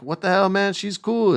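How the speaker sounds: high-pitched mock Io protesting; Io voiced high. Retelling wrestlers' feud, Io voiced in high mock protest. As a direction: squeak it out like an indignant friend. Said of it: "And Io's like".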